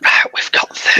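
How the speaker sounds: voiceless, conspiratorial whisper. Pretend. Host playing along with the secret squad count, whispering. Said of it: "Why are we whispering?".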